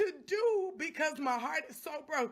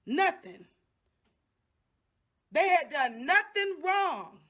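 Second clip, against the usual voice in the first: rising anger; 6 dB louder, slower than usual. Real, not acted. Mother outraged over sons' treatment.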